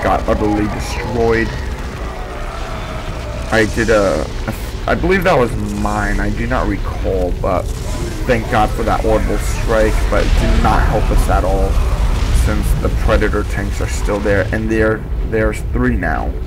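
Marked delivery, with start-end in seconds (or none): none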